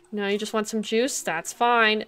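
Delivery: normal talking voice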